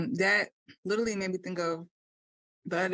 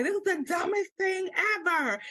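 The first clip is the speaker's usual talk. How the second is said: high, hammed-up whine about the workout; mock-dramatic groan to the trainer; dramatic complaint; 11 semitones higher than usual. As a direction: overplay the outrage. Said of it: "and also I'm very dramatic".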